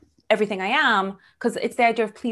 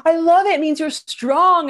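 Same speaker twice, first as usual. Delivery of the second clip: much louder, higher delighted praise; 8 semitones higher, 8 dB louder than usual. Retold or imitated. Date praising her strength, much louder and gushing. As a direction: gush with delight. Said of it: "he's like".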